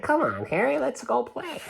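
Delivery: a friend begging the dog to come and play, high and eager; little friend's voice. Retold or imitated. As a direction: chirp it like an eager child calling a friend. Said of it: "And his friend's like".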